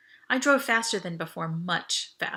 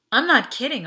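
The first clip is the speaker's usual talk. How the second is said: tense urgency; 5 semitones higher, 9 dB louder than usual. Acted. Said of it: "My voice cracked with tension".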